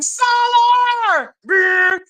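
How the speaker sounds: high slow shrieking yell; crazed mom yell. Retold or imitated. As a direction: shriek like a frantic mother. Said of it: "she was at two seconds from doing".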